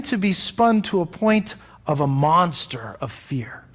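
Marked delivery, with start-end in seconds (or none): 3.0-3.7 whispered fear. Real, not acted. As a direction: breathe fear out unvoiced. Whispered word closing a thought.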